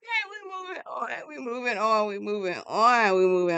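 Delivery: high start settling back to normal; brushing past it, pitch dropping; flustered moving-on. Real, not acted. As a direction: repeat it, sliding down from high to normal. Flustered, hurrying past the topic.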